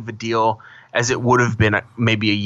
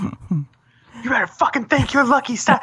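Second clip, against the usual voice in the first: high, slow mock-menacing warning; mock threat; 12 semitones higher, slower than usual. Warning his friend to be grateful, high and slow. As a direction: lean in with a high, drawn-out threat. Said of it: "And then I started going in".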